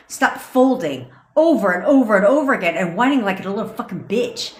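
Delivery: ordinary talk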